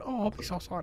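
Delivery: mock Chinese accent. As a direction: put on a caricatured Chinese accent, quietly. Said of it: "like, a Chinese accent and going".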